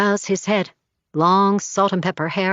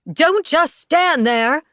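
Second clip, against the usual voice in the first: higher, slow barked order; barking; 8 semitones higher, slower than usual. A narrator's reading. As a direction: snap at him. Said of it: "barks Ella".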